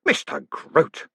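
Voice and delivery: snapping. Acted. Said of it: "snapped Moist".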